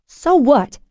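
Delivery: high, loud defiant shout. A narrator's reading. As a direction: yell it back. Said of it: "I shouted".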